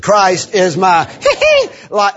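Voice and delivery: squealed whoop before "life"; slow build, high squeal, then "life"; stress on life. Shown as a demonstration. Preacher emphasizing the last word, whoop into "life". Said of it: "The last word".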